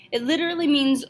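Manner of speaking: normal talking voice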